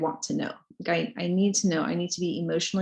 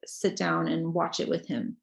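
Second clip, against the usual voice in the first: ordinary talk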